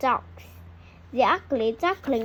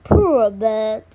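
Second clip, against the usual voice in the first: low kind man's voice; 4 semitones lower, 8 dB louder than usual. A narrator's reading. Farmer pities the frozen duckling, low man's voice. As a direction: lower and much louder, a man's voice. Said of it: "he says".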